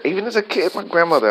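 real voice going up with feeling; voice jumping up. Real, not acted. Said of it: "I heard my voice just go up".

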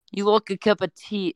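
high-pitched mock Australian accent; unintended Australian accent. Shown as a demonstration. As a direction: slip into an exaggerated Australian accent. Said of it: "I keep doing Australian".

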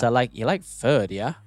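put-on slow drawn-out Valley Girl; Valley Girl accent. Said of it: "Uh, Valley Girl".

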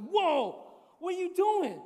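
loud, high startled cry; loud panicked surprise; alarmed whoa. Retold or imitated. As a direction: recoil in alarm at the dropper. Reacting to wife's eye drops.

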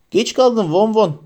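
high, slow pouting whine. A narrator's reading. Lavender pouting at Ron for being late. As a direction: sulk through a pout. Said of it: "dudağını sarkıtarak".

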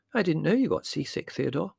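high-pitched mother figure; lightly voiced mother; surprised mother.

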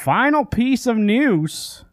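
high, stretched-out segment intro; drawn-out announcement. Real, not acted. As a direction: announce it high and slow, drawing out each word. Announces the last news item.

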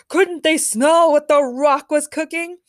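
loud, high, slow wrestler's question; indignant boast. A narrator's reading. The Rock complains about the crashed match, loud and indignant. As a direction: draw it out with showy indignation.

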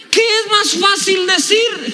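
high, slow proclaimed question; emphatic preaching cry. Real, not acted. Preacher repeating the key question to the church, high proclaiming cry.

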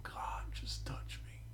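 slow, hushed plea; whispered prayer. Retold or imitated. Preacher whispering his plea to God while weeping. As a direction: breathe it out like a prayer.

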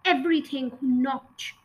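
ordinary delivery

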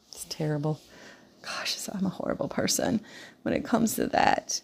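breathy unvoiced whisper. Real, not acted. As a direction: drop to a whisper for the confession. Self-deprecating aside, drops to whisper.

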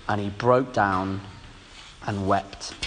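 slow, very low and soft; hushed, grave ending to a reading; sombre narration. A narrator's reading. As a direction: let the voice sink away gently. Sombre close of the denial story.

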